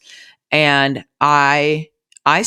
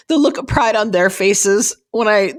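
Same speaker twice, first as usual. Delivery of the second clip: getting emotional; 5 semitones higher than usual. Real, not acted. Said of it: "I'm gonna get emotional".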